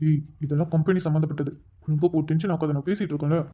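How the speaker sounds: low, soft and irritated; hushed irritation. Acted. Husband hushes his wife so the caller won't hear, low and annoyed. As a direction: snap at her without letting the caller hear. Hushed quarrel beside phone call.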